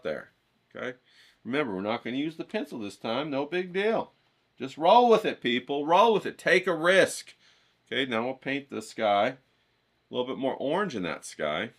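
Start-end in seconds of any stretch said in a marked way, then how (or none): 4.6-7.3 louder, higher pep-talk push; rousing encouragement. Real, not acted. Painting teacher urging viewers to take a risk, louder. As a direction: fire them up to take risks. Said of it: "coaching the viewers".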